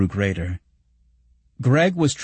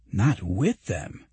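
slow, hushed astonishment; astonished; 4 dB quieter, slower than usual. A narrator's reading. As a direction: let the news sink in, incredulous. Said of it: "said Greg in astonishment".

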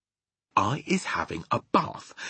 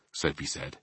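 ordinary delivery, nothing marked

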